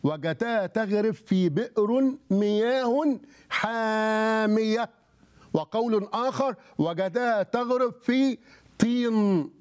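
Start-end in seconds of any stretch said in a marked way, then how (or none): 0.0-4.9 slow, higher-pitched quote, vowels held long; drawn-out recitation. Acted. Recites the commentary's reading with relish. 6.8-9.5 slow, raised pitch, held for suspense; slowly intoned quote, left hanging; drawn-out recitation. Acted. Recites the other reading for effect.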